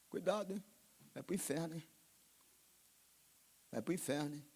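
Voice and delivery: quiet threat of hell; hushed warning. Acted. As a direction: lean in and warn them quietly.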